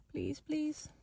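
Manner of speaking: hushed, begging whisper; whispered pleading. Real, not acted. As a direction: whisper a desperate please. Pleading at the closed shop.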